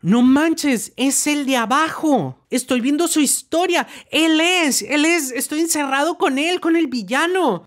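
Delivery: high-pitched disbelief at being locked in with him; retold alarmed realization. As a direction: blurt it out as if the villain is right there. Retold panic on spotting villain.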